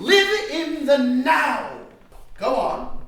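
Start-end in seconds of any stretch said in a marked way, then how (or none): 0.0-1.8 slow, loud, higher declaration; pompous guru shout; proclaiming. Acted. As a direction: boom it like a mantra.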